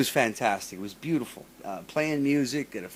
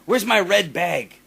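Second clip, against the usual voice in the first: demanding complaint; 6 semitones higher, 4 dB louder than usual. Retold or imitated. Mocking the singer demanding her lost bag, high and loud. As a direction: whine it like a spoiled diva. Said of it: "she comes out and she goes".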